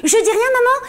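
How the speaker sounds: usual speaking voice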